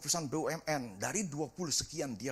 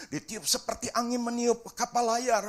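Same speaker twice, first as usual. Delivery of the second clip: ordinary delivery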